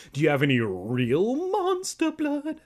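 high squeaky question, climbing; attempted voice crack. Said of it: "I can't make my voice crack".

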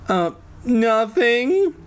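put-on high, slow, drawn-out girlish squeak; feeble, put-on. A narrator's reading. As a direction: play it weak in a thin high head voice. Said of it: "Alex said weakly".

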